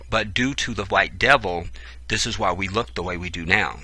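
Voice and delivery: ordinary talk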